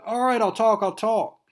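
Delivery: high, loud frantic surrender; panicked giving in. Acted. Captive caving to Wolverine's threat, high and panicked. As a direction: blurt it out, terrified of the claws.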